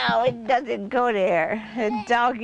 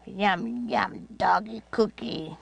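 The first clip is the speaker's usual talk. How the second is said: ordinary delivery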